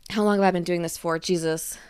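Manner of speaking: ordinary talk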